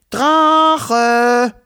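high, loud call into the distance. Acted. Children call their dragon loudly from the garden. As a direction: shout for the missing dragon. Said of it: "riefen Wuschel und Wischel".

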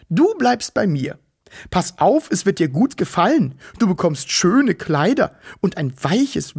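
put-on high, sugary baby-talk cooing; cooing fat woman. Fat woman coos at the little Wichtel, sugary high. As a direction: coo at him like a doting auntie.

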